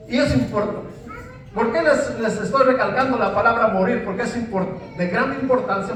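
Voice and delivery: normal talking voice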